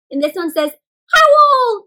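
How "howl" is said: high, loud playful howl; wolf howl. Pretend. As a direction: howl like a wolf to the moon for kids. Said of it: "Can you howl like a wolf?".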